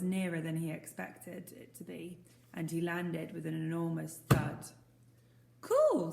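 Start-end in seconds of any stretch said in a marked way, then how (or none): 5.6-6.1 loud, high amazed cry; surprised boy. Acted. Boy on the log startled by the landing thud, amazed. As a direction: blurt it out in delight.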